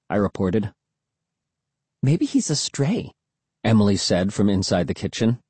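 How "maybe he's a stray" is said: put-on teen girl voice. Sister Emily voiced in story.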